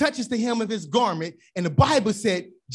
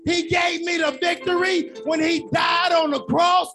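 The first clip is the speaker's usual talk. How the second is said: rising shouted sermon cadence; full-force preaching; 7 semitones higher than usual. Acted. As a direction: preach it out to the whole church. Call-and-response praise, shouting.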